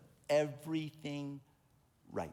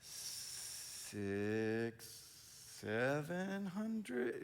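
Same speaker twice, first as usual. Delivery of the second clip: sheepish trailing; 7 dB quieter, much slower than usual. Retold or imitated. Sheepish small number.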